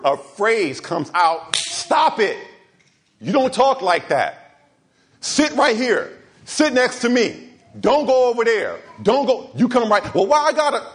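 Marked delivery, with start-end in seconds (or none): 1.6-10.1 put-on higher, firm commands to a child; stern parent. Parent correcting a misbehaving child, stern and raised. 10.1-10.8 put-on higher, childish whine; whiny child. Child complaining to a strict parent, whiny.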